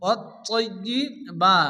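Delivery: ওয়াত-তয়্যিবা said slowly with ত and ইয়া each doubled; doubled letters. Shown as a demonstration. Teacher showing the doubled তাসদিদ in ওয়াত-তয়্যিবা. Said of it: "দুবার উচ্চারণ করে পড়তে হয়".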